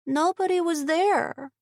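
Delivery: put-on high child's voice; little girl. A narrator's reading. Child telling her aunt the house was empty, high child voice. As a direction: speak as a young girl. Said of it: "the other child said".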